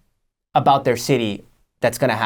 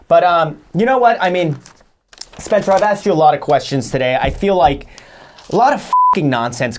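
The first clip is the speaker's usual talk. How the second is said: exasperated outburst; 7 semitones higher, 4 dB louder than usual. Real, not acted. Host abruptly confronts guest.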